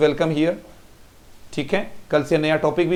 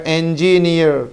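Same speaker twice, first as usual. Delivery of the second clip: Engineer said slowly with a falling end; slow engineer down so the iər is heard; slow model word; 3 semitones lower, slower than usual. Shown as a demonstration. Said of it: "ये भी उसी में आ जाएगा".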